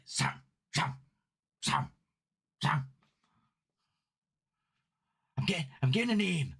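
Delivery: halting medium impression, name repeated with gaps; tentative psychic's voice rising into a botched Scottish accent; Derek Acorah. Shown as a demonstration. Streamer attempts a TV medium on request, halting impression. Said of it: "Do an impression of what, Derek Acorah?".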